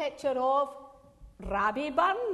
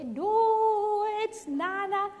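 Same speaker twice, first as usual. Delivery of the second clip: high, drawn-out retold correction; playful singsong objection; mock protest; 9 semitones higher, slower than usual. Retells correcting granddaughter's word.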